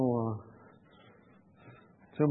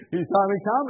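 ordinary talk, nothing marked

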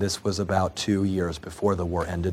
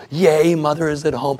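put-on high, loud cry of joy like excited children; children's happy scream; 8 semitones higher, 9 dB louder than usual. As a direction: shout it out high as kids seeing their mother return. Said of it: "And they screamed".

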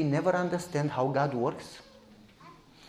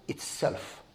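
breathy near-whisper, much softer; hushed whisper; 12 dB quieter than usual. Real, not acted. Preacher whispers the real enemy to the congregation, hushed. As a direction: lean in and whisper the point.

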